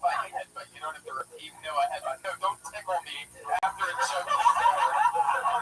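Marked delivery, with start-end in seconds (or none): 3.6-5.6 squealing laugh while tickled; words squeezed out through ticklish laughter; being tickled. Real, not acted. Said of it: "You're a very good tickler".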